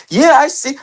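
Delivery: high eager reply; excited. Real, not acted. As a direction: jump up in pitch, eager.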